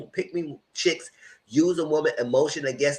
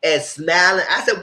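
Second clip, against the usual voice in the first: ordinary talk, nothing marked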